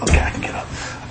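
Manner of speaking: hushed whisper. Retold or imitated. Dad sneaks out of bed in a whisper. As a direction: whisper so the boy stays asleep.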